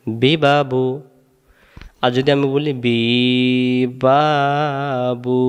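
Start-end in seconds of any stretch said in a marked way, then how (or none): 0.1-0.8 বি বা বু each clipped short and quick; short quick vowels. Shown as a demonstration. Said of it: "তিনটা একসাথে বলেন". 2.8-5.4 বি বা বু with each vowel stretched long; wrongly drawn out. Shown as a demonstration. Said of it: "তখন হারকাতের উচ্চারণ টান হয়ে গেল".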